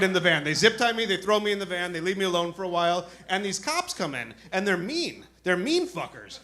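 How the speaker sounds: ordinary talk, nothing marked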